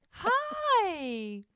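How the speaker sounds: loud, high, drawn-out mock hello; gushing greeting. Muriel turns up at their restaurant table, gushing high greeting. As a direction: sing out an over-the-top greeting.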